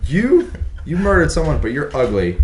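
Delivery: high, loud hammed-up judge voice; squealing high mock sentencing; mock English judge. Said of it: "They're like".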